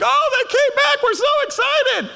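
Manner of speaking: high, loud, sarcastic squeal of fake joy; mock excitement. Churchgoer mocking the people who come back, high sarcastic squeal. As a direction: sneer at the returning ones in a high mocking voice. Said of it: "it's like".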